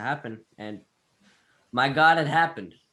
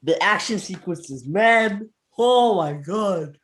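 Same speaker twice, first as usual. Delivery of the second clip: high, loud and slowed right down; excited; 7 semitones higher, 6 dB louder, slower than usual. Real, not acted. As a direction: swoon over it, dragging out Oh my God. Gushing about action scenes.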